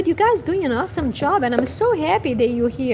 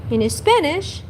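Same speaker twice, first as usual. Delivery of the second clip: ordinary talk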